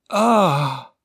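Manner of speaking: sharp high gasping cry of horror; a cry torn out of her before she can stop it; involuntary cry. A narrator's reading. Mother seeing her baby, a sudden horrified cry. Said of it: "Ce cri, elle ne put le retenir".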